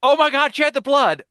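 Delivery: high, loud mock-panicked yell; sudden horrified shout, hammed up; retold scream. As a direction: yell it as a prank wake-up alarm. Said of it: "and screams, ... at the highest volume he can".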